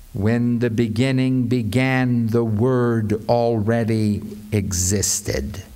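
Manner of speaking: low, measured recitation; solemn scripture reading. Shown as a demonstration. Demonstrates reading aloud, building to climax.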